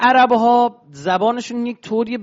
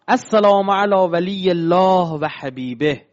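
recited Arabic; 2 semitones lower, slower than usual. Acted. Reciting Arabic pilgrimage text.

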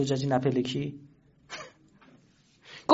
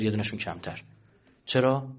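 usual speaking voice

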